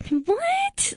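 squeaky shocked "what?" way up high; high-pitched shriek. Retold or imitated. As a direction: shoot the voice way up in shock. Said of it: "registers twenty times higher than".

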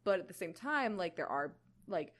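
usual speaking voice

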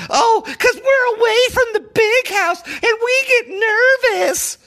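high, whiny mock panic, shouted; mocking rival fans. Fan mocking rival team's fans, high whiny mockery. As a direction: sneer at them in a high, nervous, whining voice. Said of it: "these guys".

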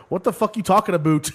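put-on Canadian accent. T snapping at drunk Leahy in Canadian accent. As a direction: yell it with a Canadian twang, half laughing. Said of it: "T's Canadian accent comes out".

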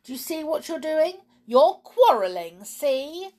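high, loud point-scoring; gleeful squabbling jab; triumphant accusation. Acted. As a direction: catch them out, pitch rising. Quarrelling goblins point the blame.

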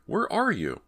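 high, searching call; alarmed call. Acted. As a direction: call out anxiously for the hidden voice. Calling back to the hidden voice.

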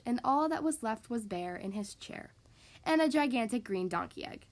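ordinary delivery

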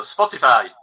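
Spotify said loud and clear in own accent; showing off pronunciation. Shown as a demonstration. Guest repeats Spotify on the host's request, showing off pronunciation. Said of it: "say Spotify again".

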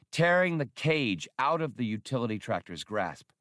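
usual speaking voice

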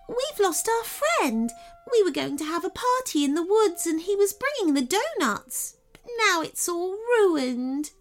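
high, small fairy voice, a touch weepy; tearful fairy. Acted. Fairy tells the girl their friend is lost, high and weepy. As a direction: sniffle through the story of a lost friend.

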